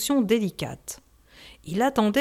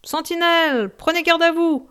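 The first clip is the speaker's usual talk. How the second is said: high, loud sentry's call; military cry; 8 semitones higher, 3 dB louder than usual. A narrator's reading. Sentry calling to sentry across a fortress, loud cry. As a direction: bark it like a sentry on the ramparts. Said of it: "comme le cri militaire".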